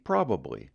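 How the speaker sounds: Probably in full, PROB-uh-blee; three syllables. Shown as a demonstration. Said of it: "Three syllables".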